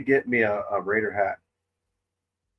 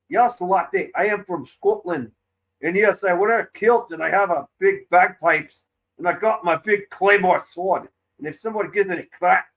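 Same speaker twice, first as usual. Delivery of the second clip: high, hammed-up Scottish brag; louder, showy Scottish accent; 6 semitones higher, 5 dB louder than usual. Shown as a demonstration. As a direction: play a boastful Scottish warrior, pitched up. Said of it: "go like this".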